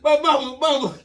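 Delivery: high wailing plea, mock-loud; loud pleading cry. Boy begging his mom during the whipping, loud and high. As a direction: cry out for mama, hammed up.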